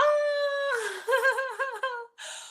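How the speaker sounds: high squealing laugh, half a cry of joy; delighted squeal. Real, not acted. Streamer squeals at viewers' compliments, a high delighted laugh. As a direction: let out a real squeal of delight.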